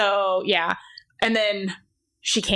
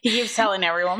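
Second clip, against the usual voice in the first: ordinary talk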